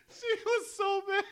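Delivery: very high squeal through laughter; helpless laughing. Real, not acted. Recalling how mad the wife was, helpless laughing. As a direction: squeeze the words out while cracking up. Breaking up at own story.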